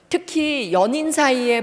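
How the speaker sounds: ordinary delivery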